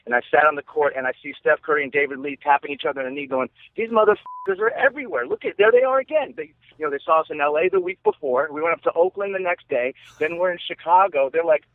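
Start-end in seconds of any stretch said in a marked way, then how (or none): 3.8-6.3 mock-incredulous player's voice, pitched much higher; retelling a player's joke with higher surprise; amused disbelief. Players spotting the same fans courtside, mock amazed.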